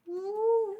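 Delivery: sudden high startled ooh; surprised ooh. Real, not acted. Streamer startled as headphones fall, high ooh. Headphones slip off mid-song.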